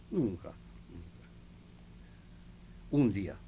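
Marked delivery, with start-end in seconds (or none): 0.1-1.3 "Nunca, nunca" softened to a slow whisper; soft, slow, breathy repeat. Real, not acted. Old man telling the interviewer he never defaulted, whispering "Nunca, nunca". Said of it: "nunca, nunca não tinha".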